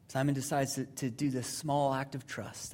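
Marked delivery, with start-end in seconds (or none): none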